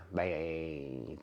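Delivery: normal talking voice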